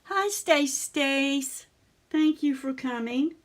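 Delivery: host welcoming a viewer into the stream, slow and high; sing-song greeting. Real, not acted. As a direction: stretch the name out fondly, then thank her. Greeting a viewer in live chat.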